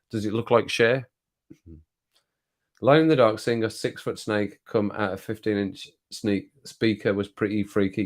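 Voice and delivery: ordinary talk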